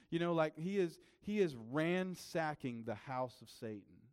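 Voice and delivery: normal talking voice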